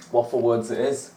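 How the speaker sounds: ordinary delivery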